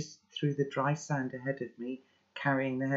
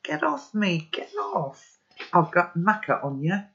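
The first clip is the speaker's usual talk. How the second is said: high, struggling protest shout; 4 semitones higher, 5 dB louder than usual. A narrator's reading. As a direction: yell to be let go, pitch leaping up. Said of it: "and shouting".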